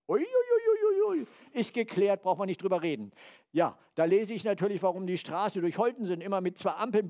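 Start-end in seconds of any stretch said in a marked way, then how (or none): 0.1-1.7 hammed-up wince at bad stock news; mock dismay. Preacher recalling his stock losses, mock dismayed high ui ui.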